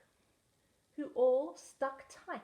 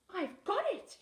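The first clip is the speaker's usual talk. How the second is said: higher and louder with sudden idea; excited cry; 10 semitones higher, 4 dB louder than usual. A narrator's reading. Snail finds a way to save whale, excited. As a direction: blurt out the idea. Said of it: "she cried".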